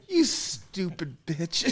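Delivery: muttered scorn. Retold or imitated. Guy privately scorning a girl on chat, slow and soft. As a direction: say it slowly to yourself. Inner thought while listening.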